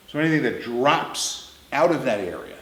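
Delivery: ordinary talk, nothing marked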